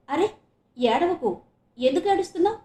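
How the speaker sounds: high, loud, worried coaxing; alarmed, caring questioning; concerned. A narrator's reading. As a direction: urge a crying friend to stop. Friend consoles crying girl.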